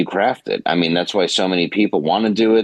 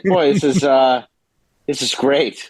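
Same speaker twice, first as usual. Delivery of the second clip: sarcastic; 7 semitones higher than usual. Retold or imitated. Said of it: "you're like".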